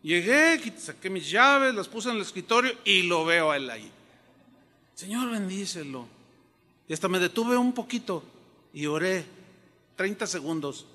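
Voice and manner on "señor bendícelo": soft prayer. Real, not acted. Praying softly for the man.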